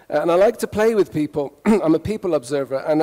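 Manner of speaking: usual speaking voice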